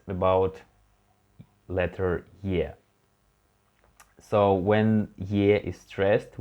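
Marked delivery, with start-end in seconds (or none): none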